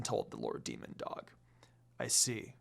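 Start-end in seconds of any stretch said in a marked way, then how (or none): 2.0-2.5 murmured. Acted. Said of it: "Sesshomaru murmured".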